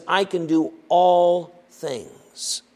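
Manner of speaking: "all" drawn out slow with a falling tune; very slow, landing firmly on "all"; stress on all. Shown as a demonstration. Preacher giving the true reading, slow, all stressed. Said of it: "He says".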